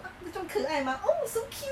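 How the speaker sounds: high gushing coo; gushing cutesy. Retold or imitated. People gushing at her baby voice, high and cooing. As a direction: coo over her like a doll. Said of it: "人家也会觉得说".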